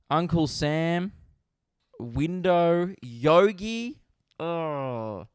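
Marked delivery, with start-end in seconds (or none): none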